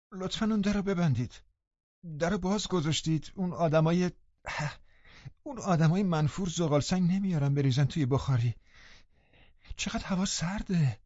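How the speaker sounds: high, soft, complaining invalid; peevish sickly boy. A narrator's reading. Linton complaining to the visitors, high and peevish. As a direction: fret about the cold door.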